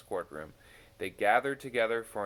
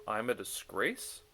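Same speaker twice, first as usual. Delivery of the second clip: usual speaking voice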